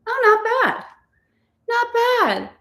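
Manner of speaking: high and delighted; a chirpy, impressed not bad; surprised approval; pleasantly surprised. Real, not acted. Pleased with cleaned glasses.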